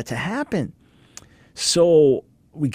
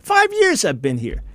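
mild retold anger; 6 semitones higher than usual. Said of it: "And he's angry".

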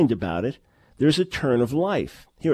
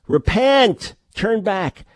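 high, louder ringing call to repent; proclaimed call; 6 semitones higher, 4 dB louder, much slower than usual. Retold or imitated. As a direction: call it out like a prophet.

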